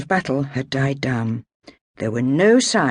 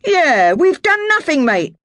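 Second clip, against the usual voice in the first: higher, louder cheeky protest; London sailor protesting innocence; indignant sailor; 14 semitones higher, 7 dB louder than usual. Acted. Ben's voice.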